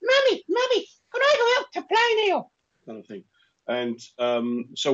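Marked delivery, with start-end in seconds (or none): 0.0-2.5 put-on very high squeaky child voice; his childhood self, pitched right up; chipmunk on helium. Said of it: "Like a chipmunk on helium".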